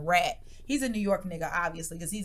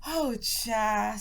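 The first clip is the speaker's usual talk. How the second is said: ordinary talk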